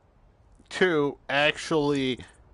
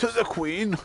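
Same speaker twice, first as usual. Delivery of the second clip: mock British cheer; 9 semitones higher than usual. Mocking British voices over game.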